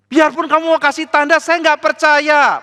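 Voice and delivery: high, loud, dragged-out refusal; hard-hearted king shouting his disbelief; stubborn defiance. Acted. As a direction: fling the refusal back defiantly. Ahas stubbornly refusing God.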